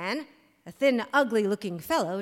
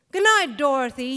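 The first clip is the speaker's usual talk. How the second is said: called back; 3 semitones higher, 6 dB louder than usual. A narrator's reading. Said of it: "he called back".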